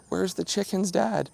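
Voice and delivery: a hint of whiny children begging dad; imitating the kids. Dad voicing kids' plea.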